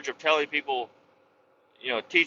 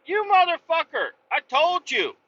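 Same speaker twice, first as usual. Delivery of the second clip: high-pitched mock exasperated scolding; exasperated rant; 9 semitones higher than usual. Boss scolding driver who ignored instructions, high exasperated rant. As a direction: berate him, voice pitched way up in disbelief. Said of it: "I'm like".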